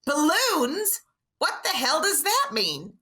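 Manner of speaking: high, drawn-out baffled question; scripted actress line. Retold or imitated. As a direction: deliver it as a puzzled scripted line. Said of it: "Kim's line is".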